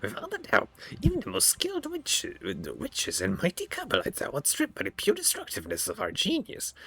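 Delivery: high, eerie surgeon creature voice; creepy homunculus voice. Acted. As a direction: voice a gloating flesh-crafter, high and strange. Homunculus voice continues.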